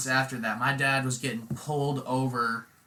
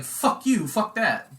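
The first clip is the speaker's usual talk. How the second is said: higher, louder mock-angry refusal; dad refusing to write the cop an apology, higher and louder; indignant retort; 9 semitones higher, 5 dB louder, slower than usual. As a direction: spit it back with outrage.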